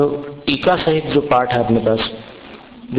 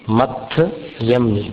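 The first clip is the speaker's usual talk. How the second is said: slow recitation; 2 semitones lower, much slower than usual. Shown as a demonstration. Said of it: "मथय यानी मस्तक".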